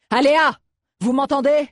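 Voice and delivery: high-pitched anxious call down a corridor; desperate. A narrator's reading. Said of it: "Il avait la voix désespérée". (appel désespéré)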